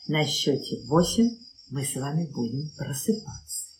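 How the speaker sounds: ordinary delivery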